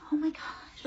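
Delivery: imitating an indignant kid, pitched high; high, gasped mock outrage; mock upset kid. Storyteller imitates a kid offended at x-ray joke, mock upset. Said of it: "he was really mad and upset".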